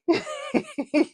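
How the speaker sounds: bursting into a high laugh; giddy squeal of a laugh; high delighted laugh. Real, not acted. Joy after celebrity notice.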